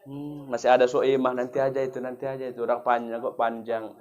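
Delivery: imitated procrastinator's low, very soft mumble; listless mock excuse, hushed and low; lazy putting-off. TV watcher delaying night prayer, lazy putting-off. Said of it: "sholatnya pun diundur-undur mulai".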